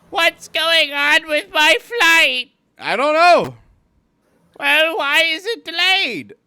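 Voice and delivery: put-on very high, loud, slow whiny old traveler; mock old passenger. Airline worker imitating an old passenger, loud and high.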